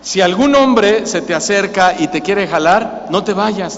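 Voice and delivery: usual speaking voice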